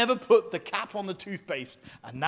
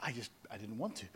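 much softer and lower, a mock sheepish mumble; 7 semitones lower, 17 dB quieter, faster than usual. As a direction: mumble it like a guilty excuse. Mimicking a sheepish excuse.

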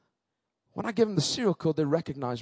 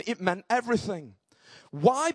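ordinary talk, nothing marked

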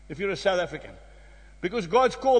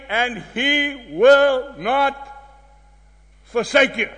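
will drawn out very slowly and rising; slow, weighted promise; slow emphasis; 3 dB louder, much slower than usual. Acted. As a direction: stretch "will" out long before "not".

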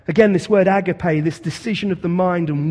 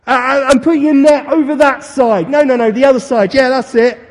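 loud, high call across the water; calling out from shore; 8 semitones higher, 11 dB louder than usual. Retold or imitated. Jesus shouting to the fishermen from the shore, loud and high. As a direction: shout directions to the boat. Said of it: "he shouts out".

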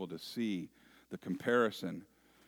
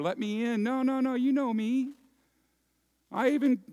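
high, insistent begging at the door; protesting outsiders; 6 dB louder than usual. Acted. Locked-out guests protesting at the door, high and pleading. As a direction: plead that he knows you. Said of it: "They're protesting, knocking on the door".

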